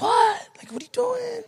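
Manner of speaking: very high squeaky child impression; imitated little daughter. Little daughter answering her yelling father, mock child voice. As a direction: put on a tiny high voice, playing innocent.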